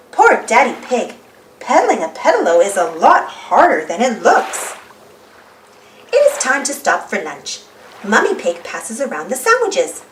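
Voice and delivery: ordinary delivery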